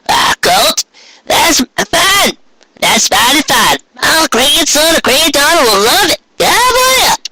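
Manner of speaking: very high, bubbly country grandma voice; pleased old pony gushing; delighted old granny. Acted.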